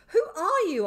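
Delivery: high, very loud challenge; shout. A narrator's reading. Boy challenges the madman on the ground, shouting. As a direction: shout the question at him. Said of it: "I shouted again".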